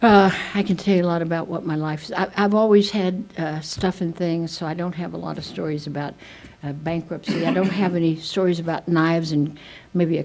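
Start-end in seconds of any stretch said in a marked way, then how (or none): none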